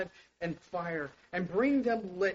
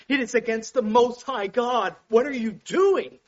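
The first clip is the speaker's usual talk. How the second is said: louder, higher indignant rebuke; Moses rebuking; 4 semitones higher, 8 dB louder than usual. Acted. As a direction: thunder it like an outraged prophet.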